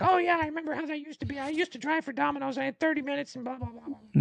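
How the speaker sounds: very high-pitched mocking babble; squeaky, high imitation of reminiscing fans; mock excited commenters. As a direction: gush in a high put-on voice, trailing into blahs. Mimics gushing online commenters.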